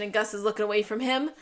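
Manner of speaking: ordinary delivery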